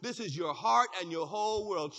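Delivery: usual speaking voice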